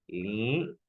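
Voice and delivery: "Li-" held long as a lone syllable; syllabifying. Shown as a demonstration. Teacher starting to syllabify for students, drawn-out "Li-". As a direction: draw out "Li-" and stop before the next syllable. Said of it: "lo vamos a silabiar".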